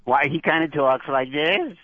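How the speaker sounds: high, slow goofy character voice; high-pitched, drawn-out puppet voice; Kerwin as young Jerry Lewis. Shown as a demonstration. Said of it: "he was kinda like young Jerry Lewis".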